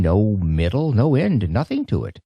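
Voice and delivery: ordinary delivery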